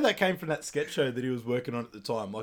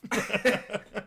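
high laugh; 9 semitones higher than usual. Real, not acted. Laughing after a retold joke.

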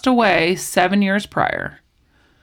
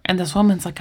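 normal talking voice

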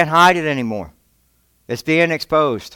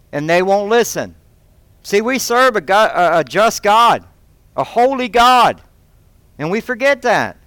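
fervent preaching; 4 semitones higher than usual. Acted. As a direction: drive the warning home to the pews. Preaching at full force.

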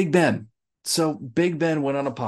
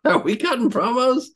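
pitched way up, teasing; high squeaky question; 13 semitones higher than usual. Real, not acted. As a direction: squeak it out again.

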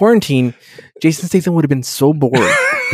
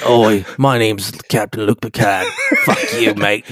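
Cockney Picard; 6 semitones lower than usual. Pretend. Cockney Picard introduces himself.

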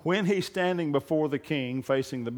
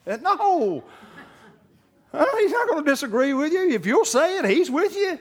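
high, loud preaching building to a shout; preaching at full force; 10 semitones higher, 5 dB louder than usual. Acted. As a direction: drive it home to the congregation. Preaching climax continues.

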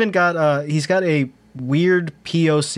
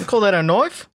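a higher, hammed-up Aussie take on the quote; mock Australian accent; 6 semitones higher than usual. Host echoing the knife line in a mock Australian accent. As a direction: sneer it like a movie bushman.

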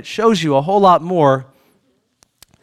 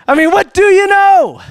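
half laughing, half shouting, much higher and louder; laughing exasperated shout; 12 semitones higher, 5 dB louder than usual. Real, not acted. Preacher to congregation, laughing exasperated shout. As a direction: throw up your hands at the blank answers, laughing. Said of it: "laugh shout".

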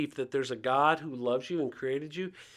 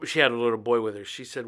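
ordinary talk, nothing marked

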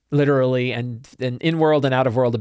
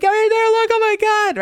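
very high, louder terrified squeal; panicked shriek; 4 dB louder than usual. Acted. Bitten Sniffles pointing at the danger, shrieking in panic. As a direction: play a frantic creature raising the alarm. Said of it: "he's pointing".

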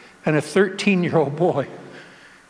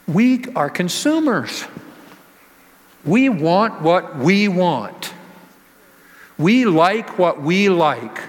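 slow emphatic; 3 semitones higher, slower than usual. Acted. Hammers the point home.

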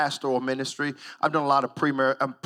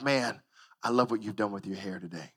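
sincere, tender; 9 semitones lower, 8 dB quieter than usual. Pretend. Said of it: "No, but instead I'm like".

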